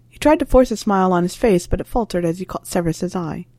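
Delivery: ordinary delivery, nothing marked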